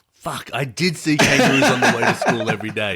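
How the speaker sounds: mock-outraged confession, pushed loud; exasperated admission. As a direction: burst it out as a grudging comic admission. Retold exasperated admission, laughter.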